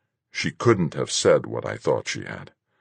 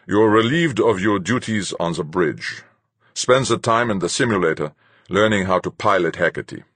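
louder, higher captain's voice; commanding captain; 5 semitones higher, 4 dB louder than usual. A narrator's reading. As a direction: lay down an order as the captain.

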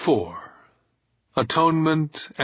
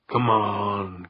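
growled. A narrator's reading. Kirk urging Nico to leave with him, growled. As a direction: growl it to your mate. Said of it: "Kirk growled to Nico".

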